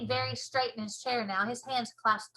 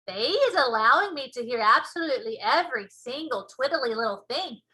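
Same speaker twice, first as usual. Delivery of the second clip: BFG giant voice; 8 dB louder than usual. Acted. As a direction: show off the ears proudly. BFG boasting.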